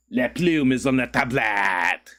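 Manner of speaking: slow, hammed-up French accent; bad French accent. Streamer asked by chat to speak French, bad French accent. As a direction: do a clumsy fake French accent. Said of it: "I don't have a good French accent".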